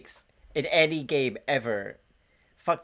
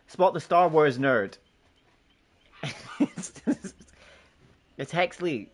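ordinary talk, nothing marked

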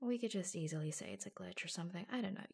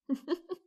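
short high giggle; playful little laugh; 9 semitones higher, 7 dB louder than usual. Acted. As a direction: giggle after teasing them. Laughs after teasing line.

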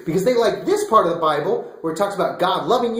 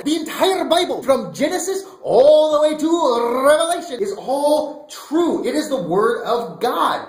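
excited proclaiming; 6 semitones higher than usual. Acted. Pastor proclaiming to kids that the whole Bible is true.

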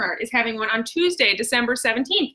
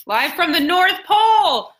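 big, slow showy announcement; announcer voice; 6 semitones higher, 5 dB louder, slower than usual. Acted. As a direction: call it out like a TV host. Announcer opening live show.

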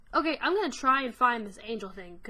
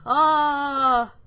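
real scream; 3 semitones higher, 14 dB louder than usual. Real, not acted. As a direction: scream at the jump scare. Jump scare scream.

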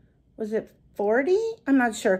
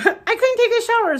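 laughing; 9 semitones higher than usual. Real, not acted. Laughing at own messy look.

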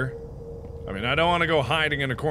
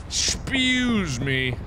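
slow, exaggerated Excuse me; drawn-out excuse me; 2 semitones higher, much slower than usual. Shown as a demonstration. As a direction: Excuse stretched out slowly, mock polite.

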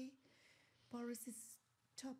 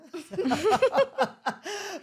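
loud laugh; 10 semitones higher, 20 dB louder than usual. Real, not acted. Laughing at the idea of the bodybuilder at McDonald's.

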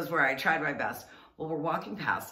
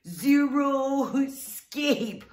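zero escape very slow, high and loud; exaggerated explaining; 9 semitones higher, 8 dB louder, much slower than usual. Shown as a demonstration. Mock explaining the garden word again. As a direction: stretch it out as if to a foreigner. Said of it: "callback to explaining".

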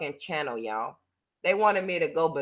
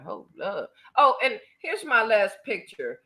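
ordinary talk, nothing marked

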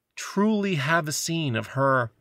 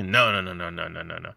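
fading refusal; 8 semitones lower than usual. Retold or imitated. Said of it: "and then he".

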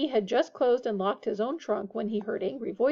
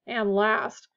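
ordinary delivery, nothing marked

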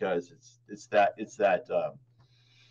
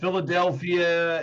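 Philadelphia accent; 4 semitones higher than usual. Shown as a demonstration. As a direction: say it like a Philadelphia local. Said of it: "Talk a little like this".

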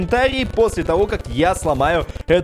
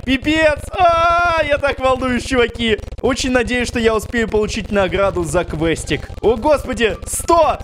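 screaming with excitement at the finish; excited shouting; 8 semitones higher than usual. Real, not acted. Boss health nearly gone.

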